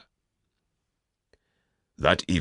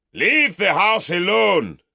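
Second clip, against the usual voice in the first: much higher, louder, sneering bellow; mock the Southerner for the mob; scornful shout; 7 dB louder than usual. Uncle orders crowd away.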